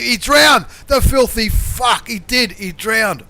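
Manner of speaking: real fury, sing-song jeer at the drowned player; furious shout. Real, not acted. Commentator yelling at the artillery that drowned itself, furious shout.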